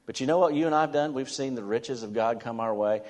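ordinary delivery

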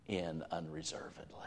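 breathy hushed whisper; whispered emphasis. Real, not acted. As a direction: drop to a whisper to close the point. Sermon point dropped to hush.

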